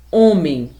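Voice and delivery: "Homem" slowly, with closed, nasal first o; closed vowel. Shown as a demonstration. Teacher gives pupils an example, "Homem" with closed first o. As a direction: stretch "Homem" with the o nearly shut. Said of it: "pronuncio sem intensidade e sem força".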